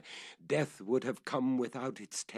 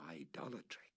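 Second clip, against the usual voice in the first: whisper; 4 semitones lower, 13 dB quieter than usual. A narrator's reading. Whispered end of phrase.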